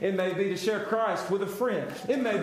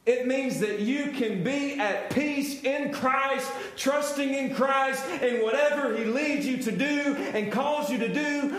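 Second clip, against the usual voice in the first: ordinary delivery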